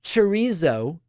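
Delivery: chorizo in an American accent, with a z sound; Americanized chorizo. Shown as a demonstration. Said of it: "said in an American accent".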